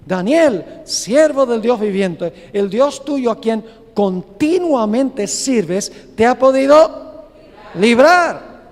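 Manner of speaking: llama fuerte y lento, con pena; llamado a voces triste. Acted. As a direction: grita su nombre hacia el foso, apenado. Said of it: "llamó a voces a Daniel con voz triste". (rey llama al foso con voz triste)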